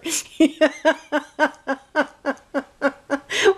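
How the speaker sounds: laughing. Real, not acted. Laughing at his reply.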